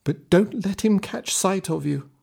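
high voice, a warning; old woman warning. A narrator's reading. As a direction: caution him, high and quick.

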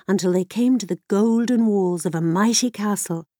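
ordinary delivery

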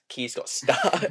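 usual speaking voice

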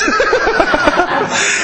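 high delighted laugh burst. Real, not acted. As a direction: laugh up high before the line. Laugh before Jesus quote.

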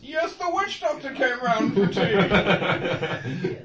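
slow, posh, laughing through it; posh hunter voice, laughing. Retold or imitated. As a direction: drawl it out like a pompous old hunter. Sean Connery hunter voice, laughing.

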